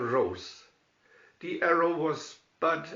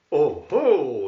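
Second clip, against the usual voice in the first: a loud, hearty mock laugh; laughing Robin; 8 dB louder than usual. A narrator's reading. Robin laughing at the sheriff's trap, loud hearty laugh. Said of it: "laughed Robin".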